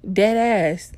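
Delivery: ordinary delivery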